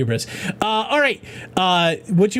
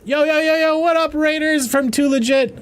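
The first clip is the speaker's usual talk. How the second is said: big welcome to the raid; hyped greeting; 8 semitones higher than usual. Real, not acted. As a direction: hype up the raiders. Greeting incoming raiders hyped.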